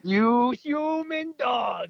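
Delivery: high, loud, slow mock insult; mock outrage. Friend mock-insulting a friend who dodges the trip, loud and high. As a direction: drag it out in fake fury.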